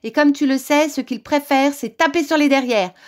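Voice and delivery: high, loud scolding witch; witch crying out. A narrator's reading. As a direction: threaten the wolf as a storybook witch. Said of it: "s'écria la sorcière".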